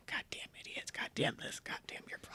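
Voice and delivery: whispered grumbling curses under the breath; grandma muttering. Retold or imitated. Grandma grumbling to herself while the child toddles along, whispered. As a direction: mutter it to yourself as you walk. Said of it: "her little, little muttering".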